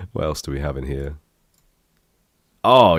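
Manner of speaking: ordinary talk, nothing marked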